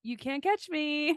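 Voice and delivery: put-on high, slow childish teasing; little kid taunt. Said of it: "little kid".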